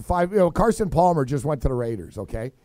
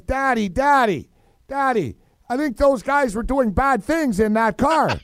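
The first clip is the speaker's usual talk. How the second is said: higher-pitched imitation of a little kid; tattling kid; 5 semitones higher than usual. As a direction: mimic a child telling on strangers to Daddy. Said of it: "And the kid says".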